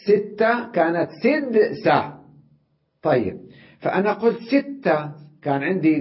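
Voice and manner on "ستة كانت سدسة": ستة pitched high, then سدسة said slowly; contrasting forms. Shown as a demonstration. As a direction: set ستة against سدسة clearly. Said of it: "فهي كانت". (مقارنة ستة وسدسة بوضوح)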